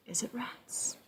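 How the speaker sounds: hushed, whispered fearful question; spooky whisper. Pretend. Showing the child the picture, a mock-scared whisper. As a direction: whisper it as if the rat might hear. Scary moment shown to child.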